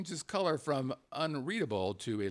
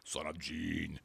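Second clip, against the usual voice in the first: low, softer mock sound effect; 10 semitones lower, 7 dB quieter, slower than usual. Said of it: "the whole sound effect thing".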